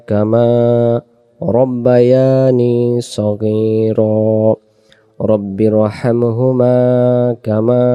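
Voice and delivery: slow, drawn-out Quranic recitation; slow duʿa recitation. Shown as a demonstration. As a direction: chant the prayer slowly, syllable by syllable.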